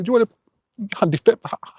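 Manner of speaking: put-on English accent with stammered, broken-off starts; stuttering English accent. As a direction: imitate an Englishman tripping on his words. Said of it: "doing the way he actually spoke".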